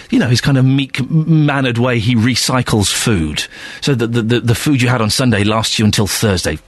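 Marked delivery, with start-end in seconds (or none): none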